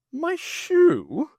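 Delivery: baffled silly toff; puzzled Jeremy. Acted. Jeremy surprised his shoe is the answer, high and bewildered. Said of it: "Jeremy puzzled".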